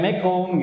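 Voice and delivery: "mekum" as a clipped-down greeting with a stretched "kum"; garbled playful greeting. Retold or imitated. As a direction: toss out "mekum" in place of the full greeting. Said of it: "Nggak boleh itu dimain-mainin". ("mekum", salam yang dimain-mainkan)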